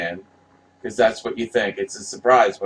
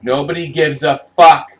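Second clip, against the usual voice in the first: higher and louder, still heated from the yell; angry rant; 5 semitones higher, 8 dB louder than usual. Real, not acted. Fan ranting at credited names on posters, angry. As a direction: spit it out at the egos on the poster.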